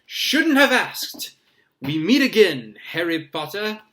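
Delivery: very loud, high, hearty boom; showman's booming delight; jovial thundering. A narrator's reading. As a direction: greet him with theatrical bluster. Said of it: "thundered jo-, uh, jovially".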